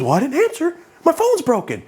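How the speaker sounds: very high, clueless excuse; imitating George. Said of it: "He just assumes".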